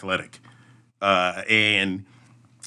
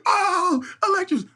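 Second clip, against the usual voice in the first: cheering crowd; slower than usual. Retold or imitated. Crowd that followed them down cheering, high excited shout. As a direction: holler like fans losing it at the finish. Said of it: "they're like".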